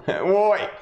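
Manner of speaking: high laughing Aussie-style oi. Pretend. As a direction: echo the greeting with a laugh. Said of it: "Salty Soul Rider's here as well".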